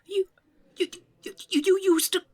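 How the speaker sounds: put-on flustered old man amazed by a computer; flabbergasted stammer. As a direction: trip over the words in astonishment.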